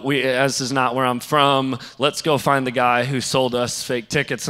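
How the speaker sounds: ordinary delivery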